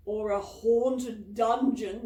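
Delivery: slow, loud spooky ghoul; ghoulish voice. A narrator's reading. Boy teases friends about ghosts in a ghoulish voice. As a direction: drag it out to scare the others. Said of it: "in a ghoulish voice".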